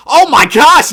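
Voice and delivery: high, loud gasp of delight; thrilled exclamation; excited outburst. Real, not acted. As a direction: burst out at the block. Big block by Richards.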